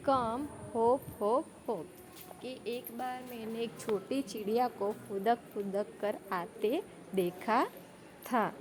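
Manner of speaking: deliberate classroom reading of the rhyme; slow poem recitation. Shown as a demonstration. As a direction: spell the verse out slowly for pupils. Reciting poem lines again.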